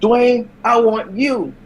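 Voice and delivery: high, slow wrestling challenge; Cody Rhodes. Retold or imitated. Wrestler calling out Dwayne in the ring, high drawn-out challenge. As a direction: call out a rival, drawing it out high. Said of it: "Cody Rhodes said".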